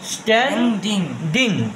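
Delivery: Standing slowly, as stan, then ding; slow two-part teaching demo of standing; split into syllables. Shown as a demonstration. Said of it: "দুই ভাগ করলাম আমরা".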